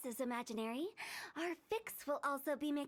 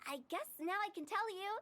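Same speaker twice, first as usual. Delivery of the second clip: ordinary delivery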